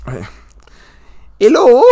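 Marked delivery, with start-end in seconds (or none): 1.4-1.8 answering phone. Pretend. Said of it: "Call her up".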